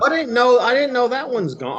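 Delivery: high-pitched, loud surprised reaction; real surprise. Real, not acted. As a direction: blurt it out, taken aback by the news. Learns that the store has closed.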